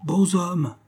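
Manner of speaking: high sudden cry of the two words; exclaiming. A narrator's reading. Peasant woman crying out to the hunters, high exclamation. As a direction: blurt it out high like a simple peasant. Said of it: "s'écria la paysanne".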